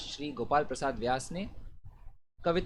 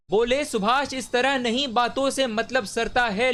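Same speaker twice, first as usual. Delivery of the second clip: higher-pitched, more urgent verse declamation; heightened declamation; 6 semitones higher, 6 dB louder than usual. Acted. Reciter voices Subhash challenging the crowd, raised rousing declamation. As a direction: lift the leader's challenge into a ringing call. Line repeated with raised intensity.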